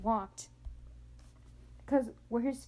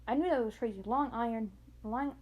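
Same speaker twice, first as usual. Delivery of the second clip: usual speaking voice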